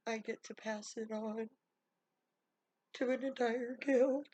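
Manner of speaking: tearful. Retold or imitated. Cobbler telling Nathaniel of his heritage, tearful. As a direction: pitch rising into a near-sob at the end. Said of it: "And he's just crying".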